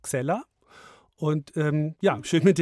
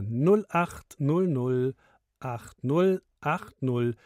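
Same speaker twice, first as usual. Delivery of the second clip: Nummer deutlich und langsam; langsam diktiert; slower than usual. Real, not acted. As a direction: jede Ziffer einzeln, bedächtig. Ansager diktiert Telefonnummer. (langsam zum Mitschreiben)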